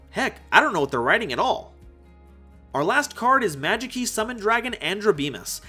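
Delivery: normal talking voice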